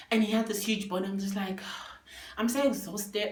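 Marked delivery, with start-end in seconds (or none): none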